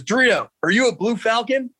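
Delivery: high barked question from the sergeant; drill sergeant yell. Retold or imitated. Sergeant yelling at a recruit on the march, mock bark. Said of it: "He goes".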